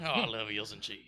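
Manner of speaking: mock Southern accent. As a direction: relish it as a Southern husband. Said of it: "Somebody's southern husband is here".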